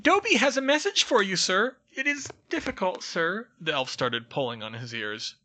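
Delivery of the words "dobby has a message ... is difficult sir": very high, loud, squeaky elf voice; a tiny servant gushing with awe; eager house elf. Acted. House elf meets Harry, squeaky and adoring. Dobby's voice held.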